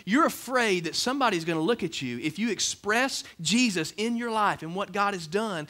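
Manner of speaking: normal talking voice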